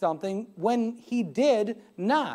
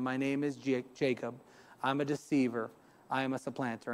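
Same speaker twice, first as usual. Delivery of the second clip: much softer and lower, a hushed admission; quiet confession; 10 semitones lower, 11 dB quieter than usual. Retold or imitated. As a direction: confess it in a low, soft voice. Jacob confesses to the angel.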